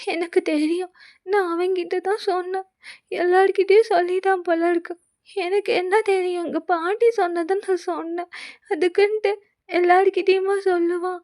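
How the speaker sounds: put-on mildly tearful young woman. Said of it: "அழுகாத, அழுகாதன்ட்டு".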